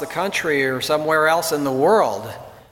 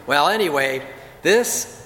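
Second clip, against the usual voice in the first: ordinary talk, nothing marked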